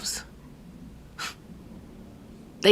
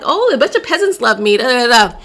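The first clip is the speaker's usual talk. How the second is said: mocking brag; 2 semitones higher, 4 dB louder than usual. Mimicking a woman proud of peasants' approval, mocking brag. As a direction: imitate someone showing off, singsong and dismissive.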